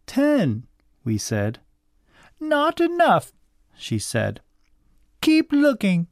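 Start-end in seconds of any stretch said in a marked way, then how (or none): 0.0-0.7 put-on high child's voice; high, storybook child reply; children answering. A narrator's reading. 2.4-3.3 very high, impatient command; high-pitched bossy child voice; bossy cousin. A narrator's reading. Bossy cousin rejects answer. 5.2-6.1 very high, impatient command; high-pitched bossy child voice; bossy cousin. A narrator's reading. Bossy cousin giving orders.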